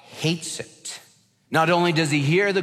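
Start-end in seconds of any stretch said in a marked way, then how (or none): 0.1-1.0 hates it whispered for weight; whispered emphasis. Real, not acted. Preacher stressing that God hates grumbling, whispered.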